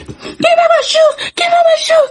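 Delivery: high, shrieking demand; yelling. Retold or imitated. Chasing the girl who took her shoes, shrieking. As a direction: scream after her for the shoes. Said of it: "chasing her".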